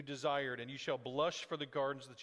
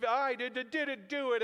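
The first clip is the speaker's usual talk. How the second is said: put-on higher, louder whiny kid denial; mock child tantrum; 7 semitones higher, 4 dB louder than usual. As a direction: play the caught kid stammering. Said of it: "they're throwing a huge".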